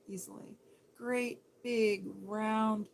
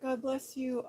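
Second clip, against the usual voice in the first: normal talking voice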